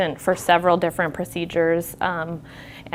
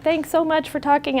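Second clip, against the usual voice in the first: high-pitched thankful quote; high imitation of a caller's thanks; imitated grateful patient; 9 semitones higher than usual. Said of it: "she was like".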